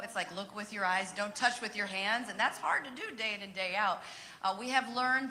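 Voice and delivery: ordinary talk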